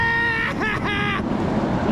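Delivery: thrilled laugh at the horn; delighted high laugh. Real, not acted. Truck honks its horn.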